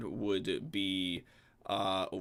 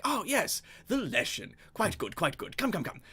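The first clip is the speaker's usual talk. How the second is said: a hint of a pleased nobleman, pitched up; lightly voiced Benjamin; 11 semitones higher than usual. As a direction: welcome them with mild delight. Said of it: "Benjamin is just like".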